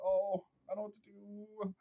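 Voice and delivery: high whiny put-on; mock helpless man. Mocking an indecisive man, high helpless whine. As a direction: sound lost and helpless.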